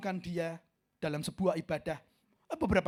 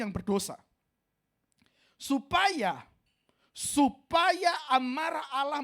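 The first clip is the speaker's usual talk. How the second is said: fiery preaching; 6 semitones higher, 8 dB louder than usual. Acted. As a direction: thunder out the warning. Preaching on God's wrath. (khotbah keras dan lambat tentang murka Allah)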